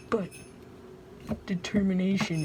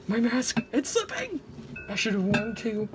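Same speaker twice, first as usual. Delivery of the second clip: higher, urgent alarm; panicked outburst; 6 semitones higher than usual. Real, not acted. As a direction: squeal as the mask slips. Mask slipping during messy mixing.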